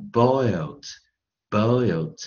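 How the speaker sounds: "boiled" slow, as one single syllable; one-syllable pronunciation. Shown as a demonstration. Teacher showing boiled is one syllable, slow emphatic repetition. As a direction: model boiled slowly, the E silent. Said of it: "一个音节".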